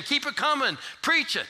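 high urging shout; church call-back. Retold or imitated. Congregation urging the preacher, high shout. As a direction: cheer the preacher on. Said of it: "raising their hand and saying".

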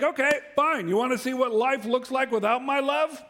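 hammed-up huffy reply; exasperated retort. Preacher jokes about how he'd answer. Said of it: "I'd be like".

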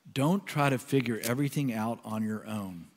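unhurried, softened reading of the line; slow prayerful reading. Acted. Pastor rereading the verse in prayer, slow and soft. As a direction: read it slowly and let it sink in. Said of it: "I'm gonna read it slowly".

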